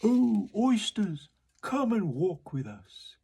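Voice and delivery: the walrus luring the oysters; pleading walrus. Acted. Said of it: "the walrus did beseech".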